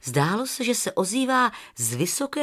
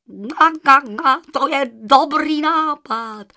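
higher, loud slow honking goose; 6 semitones higher, 7 dB louder, slower than usual. Acted. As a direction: honk with delight. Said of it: "odpověděla husa".

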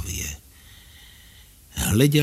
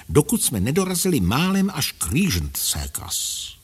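usual speaking voice

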